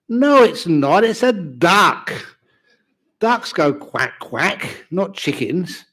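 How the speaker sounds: stubborn husband arguing; insistent husband. Acted. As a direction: correct her firmly, word by word.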